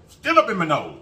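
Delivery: high exclamation, falling away at the end; real wasabi reaction. Real, not acted. Eater with wasabi up her nose, exclaiming high. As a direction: cry out from a burning nose.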